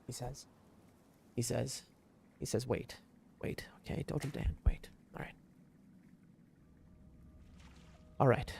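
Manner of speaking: hushed self-calming whisper. Real, not acted. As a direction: whisper yourself calm. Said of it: "He calms down".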